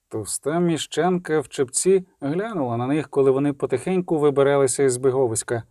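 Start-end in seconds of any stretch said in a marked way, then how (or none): none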